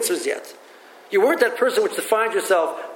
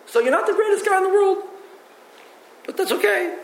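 much higher, fond teasing tone; God shrugging off a flawed man with affection; indulgent God voice; 12 semitones higher than usual. Retold or imitated. God voiced speaking to the person.

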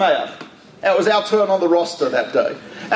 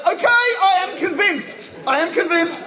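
mock convinced; 9 semitones higher than usual. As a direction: act like you've just been handed proof. [name] finally gets his sign.

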